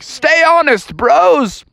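high-pitched sendoff shout; cheery parting call. Acted. Abe swims off from his friends, high parting call.

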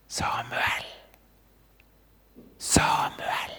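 drawn-out whisper of a name; hushed, voiceless calling. Acted. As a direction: whisper the name to a sleeper, slowly. The voice calls again at night.

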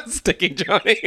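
high, loud, through laughter; laughing. Real, not acted. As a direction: barely get the name out for laughing. Laughing at the bookie's name.

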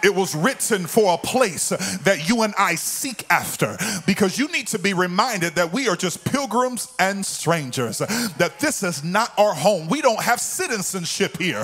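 high, impassioned pulpit cry, building in pitch; fired-up church preacher in full flow; full-force preaching. Real, not acted. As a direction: drive the sermon up to a climax, then call for praise. Preaches to a climax.

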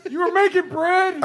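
high, laughing frog question. Acted. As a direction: squeak it as a puzzled frog. Frog character misunderstands.

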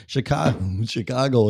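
a strong Chicago accent on "Chicago". Shown as a demonstration. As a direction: say "Chicago" with the flat Chicago "Shi-kah-go". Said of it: "I still have my Chicago accent".

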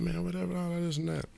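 high, brushing-off mock; dismissive Gary. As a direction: wave it all away. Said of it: "Gary like".

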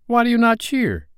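high, indignant demand; hotly. A narrator's reading. Peter to boys who will not cheer, hot high demand. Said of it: "he said hotly".

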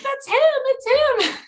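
high-pitched mock scream; retold fan scream. Fan spotting the star onstage, mock screaming. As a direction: squeal it like a thrilled fan. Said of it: "screaming my brains out".

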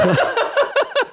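very high squeal of laughter. Real, not acted. Amused player losing it at the table, squealing laugh.

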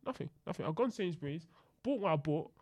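ordinary delivery

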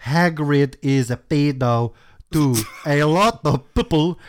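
exaggerated mock amateur-writer voice; put-on author's-note rant. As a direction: stumble crossly through the misspellings.

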